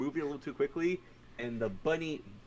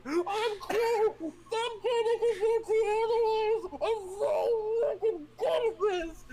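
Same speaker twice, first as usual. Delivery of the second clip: high, slow strained screech; hammed-up wailing yell; 4 dB louder, slower than usual. DM playing James defending himself, frantic mock wail. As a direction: wail defensively, drawing out every word.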